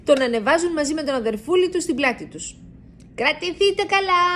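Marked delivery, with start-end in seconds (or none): 3.1-4.2 higher bat voice calling a warning. A narrator's reading. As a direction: bats telling riders to hold tight. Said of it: "φωνάζουν".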